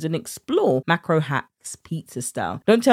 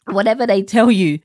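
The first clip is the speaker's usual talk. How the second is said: laughing; 3 semitones higher, 6 dB louder than usual. Real, not acted. Laughing after quote.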